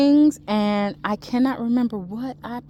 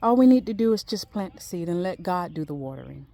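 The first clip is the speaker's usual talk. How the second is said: ordinary talk, nothing marked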